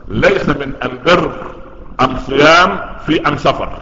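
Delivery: am- in place of al- in أم بر, أم صيام, أم سفر; Himyar dialect article. Acted. Prophet answers the Himyar guests in their dialect, am- for al-. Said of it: "هي نطق الألف لام ألف ميم".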